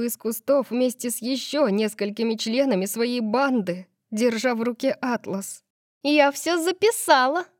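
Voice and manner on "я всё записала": put-on high-pitched gleeful mean girl; triumphant. A narrator's reading. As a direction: crow it triumphantly in a raised pitch. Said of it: "торжествующе сказала она Сету". (торжествующе, высоким голосом)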